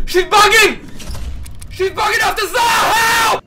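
high loud panicked yelling; real frantic cries; frantic high shout. Real, not acted. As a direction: shriek it in panic. Frantic after scare.

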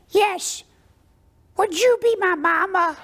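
very high pleading duckling; squeaky duck voice, begging sweetly; cartoon duck. Boy answers the order taker as the duck, high pleading duck voice. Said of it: "put the window back down and said".

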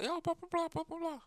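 squeaky, very high voice; high cartoonish mock voice, trailing off; mock falsetto email. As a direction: toss off the teacher email in a silly squeak. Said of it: "be like".

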